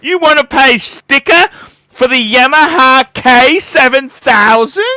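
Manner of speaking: high, loud sneering salesman voice, slow; mocking snooty salesman. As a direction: sneer down at the buyer like a snobby dealer. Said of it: "I don't wanna be laughed at and said".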